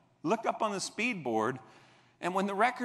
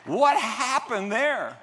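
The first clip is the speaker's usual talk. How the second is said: amazed; 7 semitones higher, 7 dB louder, much slower than usual. Retold or imitated. Laughing wonder.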